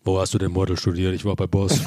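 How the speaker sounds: low rapper voice, spoken; deep spoken rapper impression; [name]. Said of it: "vom neuen [name]".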